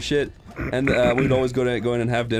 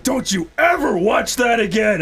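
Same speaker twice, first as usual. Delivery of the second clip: high, slow, emphatic scolding; pissed-off dad; 6 semitones higher, 4 dB louder than usual. Retold or imitated. Dad forbidding kid the show, angry scolding. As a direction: lay down the law as an angry father. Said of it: "he was so fucking pissed off".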